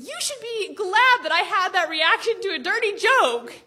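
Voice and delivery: put-on high-pitched teen pleading her case. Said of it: "I tried to plead my case".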